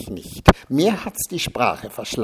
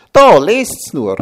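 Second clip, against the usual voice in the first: higher, louder, slow proud command; a much louder, gloating here, read it; triumphant; 6 semitones higher, 11 dB louder, slower than usual. A narrator's reading. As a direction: thrust it at them in triumph. Liesel triumphantly shows clipping.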